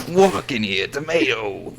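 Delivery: hammed-up Jersey street accent; New Jersey accent. Friend playing along with tomato, loud Jersey accent. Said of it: "a little New Jersey still left in you".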